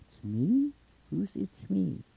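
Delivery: high, loud puzzled query; puzzled monster. A narrator's reading. Monster puzzles over the answer.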